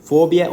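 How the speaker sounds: Fobia said high and loud as a correction; stressed correction. Shown as a demonstration. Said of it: "no es característico que el paciente tenga fobia".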